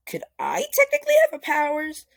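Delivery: high, fast and loud with excitement; eager question. Acted. Deku asking Wells, eager and fast. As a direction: blurt it out, thrilled at the idea.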